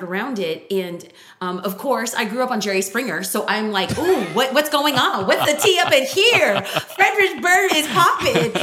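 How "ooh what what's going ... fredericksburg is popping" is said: high, loud, juicy gossip squeal; nosy gossip thrill. Retold or imitated. Spotting a crowd round a car, excited gossip squeal. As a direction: gasp it out like you smell drama.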